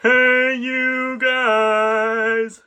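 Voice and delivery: shouted greeting echoing in a cave; calling out. Real, not acted. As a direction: call out loudly to friends.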